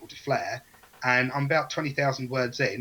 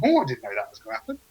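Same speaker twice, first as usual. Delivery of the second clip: high, quick delighted surprise; surprised; 9 semitones higher, faster than usual. Pretend. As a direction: exclaim it as a happy discovery. Author surprised by own story.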